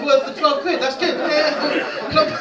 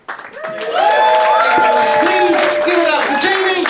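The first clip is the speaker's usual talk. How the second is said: loud, slow show host callout; announcer; 8 semitones higher, 8 dB louder, slower than usual. Real, not acted. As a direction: bring the crowd up for the winners. Host closing show, announcing winners.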